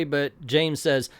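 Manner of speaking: normal talking voice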